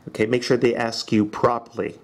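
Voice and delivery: usual speaking voice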